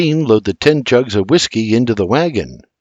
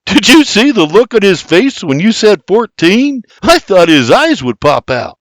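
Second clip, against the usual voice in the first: much higher, louder gleeful hoot; 8 semitones higher, 5 dB louder than usual. A narrator's reading. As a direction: crow with delight at the deal. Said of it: "William hoots".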